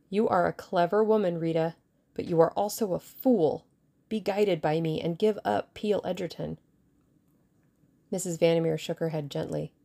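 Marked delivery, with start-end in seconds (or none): none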